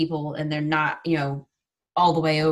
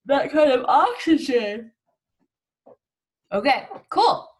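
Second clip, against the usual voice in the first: yawning; 7 semitones higher, 6 dB louder than usual. Real, not acted. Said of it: "sorry for the yawns".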